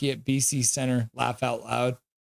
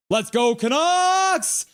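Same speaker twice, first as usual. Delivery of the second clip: high, slow drawn-out holler; rousing arena cheer; fan cheer; 11 semitones higher, 4 dB louder, much slower than usual. Real, not acted. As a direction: stretch out the team name in a high shout. Cheering on the team.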